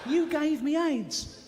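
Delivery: high, slow, hammed-up accusing voice; mock accusation. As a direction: drag out an outraged blame, pitched high. Repeated confrontation, acted.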